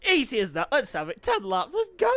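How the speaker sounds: giddy excitement after a high laugh. Real, not acted. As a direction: burst with giggly eagerness before the start. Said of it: "I'm really giddy".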